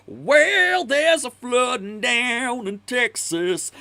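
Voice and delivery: high, slow, strained blues singer; sing-speak it like a bluesman, stretched out. Retold or imitated. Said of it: "His real voice is like".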